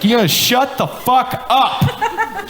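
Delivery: high, slow, drawn-out threat; hammed-up angry mother, high and slow; mock furious mom. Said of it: "She's like".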